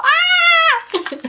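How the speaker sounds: very high, loud squeal of delight; joyful squeal. Retold or imitated. Grabbing ten bags at the pharmacy, thrilled squeal. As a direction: let out a thrilled scream.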